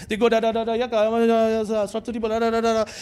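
quick da da da counting babble; playful counting chant; mock counting noises. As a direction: rattle off nonsense like counting bills. Mimics money changer counting bills.

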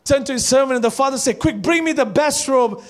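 loud, high, driving sermon; full-force preaching. Acted. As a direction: preach it to the whole church.

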